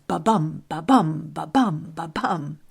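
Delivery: ba-bum with the bum stressed; heartbeat rhythm. Shown as a demonstration. Podcaster imitating the beating of hearts, heartbeat rhythm. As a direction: beat out ba-bum evenly like a pulse. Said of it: "mimic the beating of our hearts".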